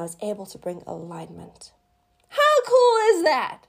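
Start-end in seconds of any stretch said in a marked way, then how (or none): 2.3-3.6 high, loud delighted exclaim; thrilled. Real, not acted. Sharing her healing with viewers, high delighted burst. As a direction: burst out with joy.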